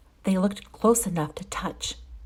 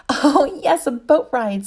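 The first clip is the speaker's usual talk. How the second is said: giggling; 6 semitones higher, 7 dB louder than usual. A narrator's reading.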